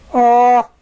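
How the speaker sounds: long high disappointed "Aw"; a loud, high sigh of letdown. A narrator's reading. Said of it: "said Berry Cake".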